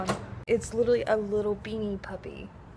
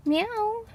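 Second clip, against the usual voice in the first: high mock meow; 10 semitones higher than usual. Meowing at a cat.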